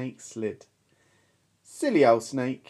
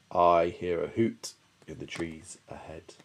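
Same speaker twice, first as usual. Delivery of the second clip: Gruffalo; 8 semitones lower, 3 dB quieter than usual. Acted. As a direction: rumble it deep and wary. Gruffalo speaks.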